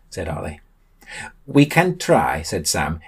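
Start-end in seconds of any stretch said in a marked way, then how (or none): 1.4-2.4 mild resolve, pitched up; determined. A narrator's reading. Sam answers Ollie's doubt about holding breath, determined. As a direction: say it with resolve, falling firmly at the end. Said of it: "in determined fashion".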